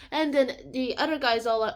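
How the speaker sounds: normal talking voice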